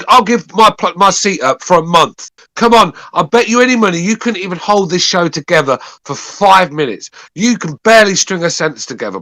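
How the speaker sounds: heated yelling; tearing into a heckler; angry shouting. Real, not acted. Angry rant at caller.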